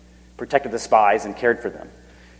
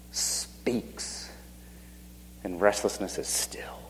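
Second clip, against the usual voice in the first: hushed, breathy whisper; 6 semitones lower, 16 dB quieter than usual. Acted. Preacher hushes the crowd as God stills the waves, whispering. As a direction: whisper as if calming the sea.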